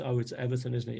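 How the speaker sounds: ordinary talk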